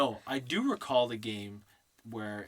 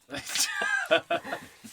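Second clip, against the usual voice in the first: loud, high burst of laughter; real laugh; 6 dB louder than usual. Real, not acted. Host cracking up at the sight of Nino, laughing. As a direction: crack up helplessly. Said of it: "Sorry, I'm laughing 'cause I'm looking at".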